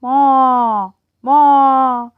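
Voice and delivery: loud drawn-out "moo, moo"; loud playful cow lowing. Pretend. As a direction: moo like a big cow in the field. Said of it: "I say".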